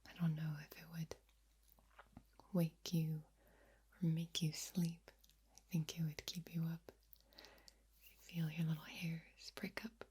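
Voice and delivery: ordinary talk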